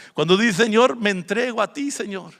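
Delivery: loud cry of surrender to the Lord; fervent prayer. Real, not acted. Pastor showing the congregation a prayer, fervent. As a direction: pray it out with fervour.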